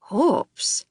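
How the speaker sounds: a hint of a Scottish accent, grudgingly impressed; lightly voiced Scottish gardener, impressed despite himself; grudgingly impressed Scot. A narrator's reading. Scottish head gardener to a recruit, lightly Scottish and grudging. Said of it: "Mac's gruff Scottish voice sounded grudgingly impressed".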